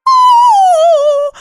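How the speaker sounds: sudden high shocked cry; horrified cry. Retold or imitated. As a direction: gasp out in horror. Horrified at game sex scene.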